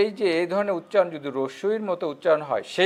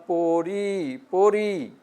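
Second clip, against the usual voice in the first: পড়ি stretched, its final ই held; drawn-out ending; much slower than usual. Shown as a demonstration. Teacher showing students how to pronounce it, পড়ি drawn out. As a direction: hold the last vowel of পড়ি long. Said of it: "রস্বই উচ্চারণ হচ্ছে".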